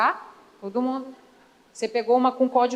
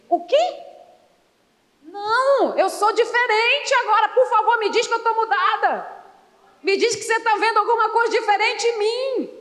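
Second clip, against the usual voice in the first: mock pleading; 6 semitones higher than usual. Acting a woman pleading she changed.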